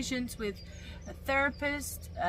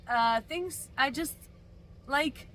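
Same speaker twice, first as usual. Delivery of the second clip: ordinary delivery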